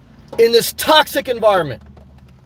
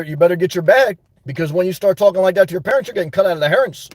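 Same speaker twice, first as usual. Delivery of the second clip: normal talking voice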